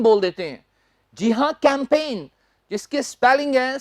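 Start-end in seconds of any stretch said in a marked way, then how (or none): none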